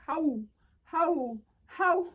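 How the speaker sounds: high, loud hooting "how"; imitated mocking laugh. Passerby laughing at her house, imitated mocking hoot. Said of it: "he starts laughing".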